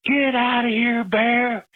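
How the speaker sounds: high, drawn-out holler; retold yell. Caller retells going back out to chase off the bear, high retold yell. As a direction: yell it slowly and high, without full volume. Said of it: "and I yelled".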